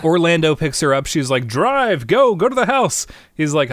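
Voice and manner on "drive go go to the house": high-pitched urgent orders; playful panicked insistence; mock frantic command. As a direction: urge the driver on, hammed-up. Acts frantic woman ordering driver.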